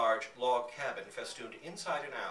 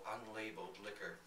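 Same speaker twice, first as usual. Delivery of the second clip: ordinary delivery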